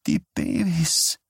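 high, slow lisping woman's voice; a patient in trance speaking as a woman. A narrator's reading. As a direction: lisp it slowly in a woman's voice. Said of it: "lispelte Johnny".

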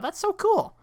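very high, gushing delight; excited. Retold or imitated. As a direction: squeal it with sudden delight. Quoting own excited thought.

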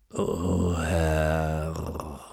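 deep, rumbling lion; growling lion voice. Acted. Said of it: "sagte die grollende Löwenstimme".

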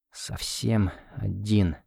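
"Совсем" leaned on, then a slow soft fall; sinking despair. A narrator's reading. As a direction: let "Совсем один" fade out, slow and soft. Repeats it, sinking.

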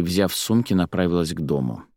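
usual speaking voice

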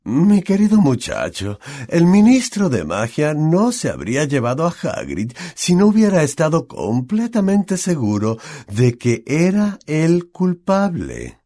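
high, over-deliberate lecturing voice; condescending teacher, stretching the final words; patronising explanation. A narrator's reading. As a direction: explain it as if one and one are two. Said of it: "en el tono que emplearía cualquiera para explicar".

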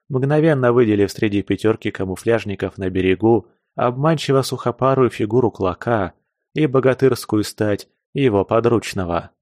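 ordinary delivery

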